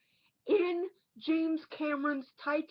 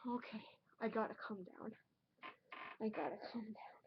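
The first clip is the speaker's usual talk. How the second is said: breathless whisper, fast, to himself; whispered self-calming; 5 semitones lower, 17 dB quieter, much faster than usual. Acted. Furious reviewer talking himself down in a whisper. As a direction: hush yourself down mid-rant in a rushed whisper. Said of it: "I am just so furious".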